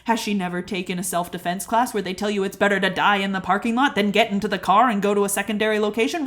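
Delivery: ordinary talk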